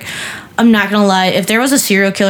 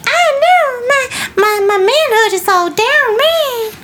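very high, wailing mock of a fragile man; mocking whiny man; slower than usual. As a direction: whimper it like a man mourning his lost manhood. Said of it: "And they're like".

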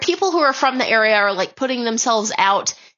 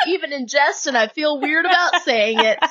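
ordinary talk, nothing marked

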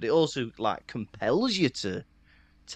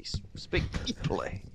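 hushed scolding whisper; 4 semitones lower, 9 dB quieter than usual. Retold or imitated. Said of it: "Why are you speaking like a road man?".

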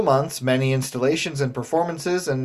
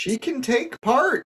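insistent; 10 semitones higher, slower than usual. Acted. Host insisting, pitched up.

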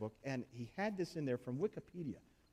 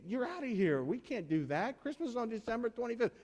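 mock outrage; 7 semitones higher than usual. Congregation voiced throwing pastors out.

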